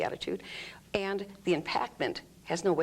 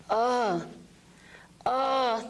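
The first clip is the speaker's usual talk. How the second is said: adding voice; 6 semitones higher than usual. Shown as a demonstration. Client told to add voice to the exercise. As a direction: push the voice out on the effort. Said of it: "voice comes out".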